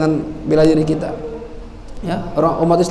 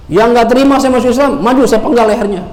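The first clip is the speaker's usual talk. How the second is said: defiant challenge; 6 semitones higher, 4 dB louder than usual. Acted. Preacher repeats Umar's challenge.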